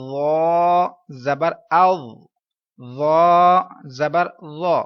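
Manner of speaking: very slow ذا as a heavy "dhaw", no whistle; full-mouth letter. Shown as a demonstration. Teacher shows the heavy letter, slow full ذا. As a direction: draw out ذا loudly with a rounded, full vowel. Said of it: "اس کو کیسے پڑھنا ہے".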